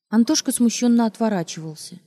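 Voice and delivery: plain reading voice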